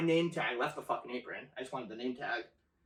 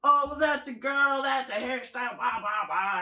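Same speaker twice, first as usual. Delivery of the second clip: high, loud dismissive mimicry; mock the excuse, starting loud and trailing into blah-blah; exaggerated brush-off of a complaint; mocking boss imitation; 8 semitones higher, 4 dB louder than usual.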